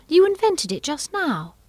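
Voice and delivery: put-on high indignant girl; accusing Alice. A narrator's reading.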